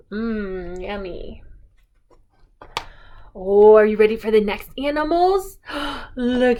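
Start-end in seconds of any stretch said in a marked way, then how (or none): 0.1-1.4 yummy. Acted. Savoring food for toddler.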